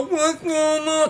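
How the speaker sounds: high, slow, garbled speech; numb-tongued. Acted. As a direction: talk with a useless, numb tongue. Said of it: "your tongue is numb".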